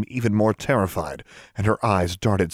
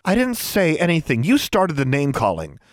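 higher, accusing comeback; angry retort; 7 semitones higher than usual. A narrator's reading. Anna blames Tomo for name-calling, arguing. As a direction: argue back, pitched up.

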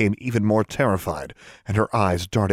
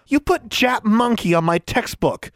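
put-on high-pitched indignant girl; indignant exclamation; 13 semitones higher, 3 dB louder than usual. A narrator's reading. Tomo accuses Anna over her textbook, indignant. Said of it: "Tomo exclaimed indignantly".

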